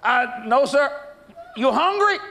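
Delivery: high, quick questions from a stranger. Retold or imitated. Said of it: "he said".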